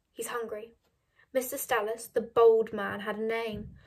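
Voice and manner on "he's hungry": hushed, softer, tearful murmur; sniffling. A narrator's reading. Mother asking daughter to share ham, sniffling. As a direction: say it quietly after crying. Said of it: "said Mother, sniffling".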